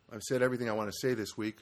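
usual speaking voice

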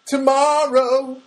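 high, loud, drawn-out TOMORROW; sudden loud, high exclamation; emphatic proclamation. Real, not acted. Exclaims the word just said.

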